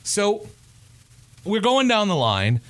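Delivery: usual speaking voice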